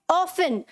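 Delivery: often said as OFF-un, no T sound; often pronounced without its T; often with silent T. Shown as a demonstration. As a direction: say often like "awfun", the T left silent. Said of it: "इसे कैसे बोलना है".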